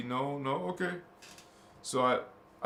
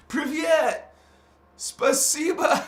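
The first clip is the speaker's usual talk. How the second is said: loud, much higher "Privyet" and "Spasiba", slow and playful; excited Russian greeting; 11 dB louder, slower than usual. Real, not acted. Host greeting a Russian viewer, loud playful hello. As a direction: bounce the Russian words out with delight.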